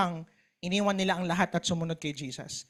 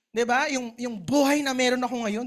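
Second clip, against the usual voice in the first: normal talking voice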